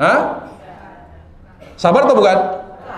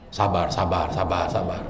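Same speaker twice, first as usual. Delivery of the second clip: self-calming murmur; 12 semitones lower, 8 dB quieter than usual. Retold or imitated. Self-soothing under insult.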